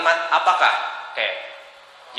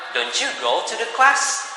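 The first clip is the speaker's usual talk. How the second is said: slow, higher doubting question; doubtful question; 5 semitones higher, slower than usual. Shown as a demonstration. Teacher voicing a friend doubting a classmate will attend, slow and doubting. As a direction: ask as if you suspect they'll skip. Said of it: "agak kurang meyakinkan".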